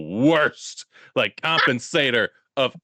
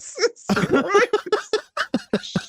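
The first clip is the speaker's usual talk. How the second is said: high, drawn-out words through real laughter; laughing; much slower than usual. Real, not acted. Co-host reacting to the Hannibal joke, laughing hard. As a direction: crack up slowly on the words.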